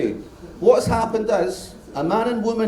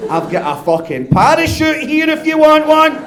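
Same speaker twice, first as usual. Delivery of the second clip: mock captain outburst; 8 semitones higher, 6 dB louder than usual. Captain punchline, voiced.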